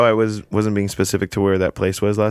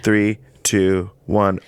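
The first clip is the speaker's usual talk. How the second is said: ordinary delivery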